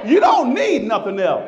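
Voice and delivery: loud preaching shout; shouted declaration. Acted. Pastor preaching to the congregation, loud shout. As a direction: hit it hard like the climax.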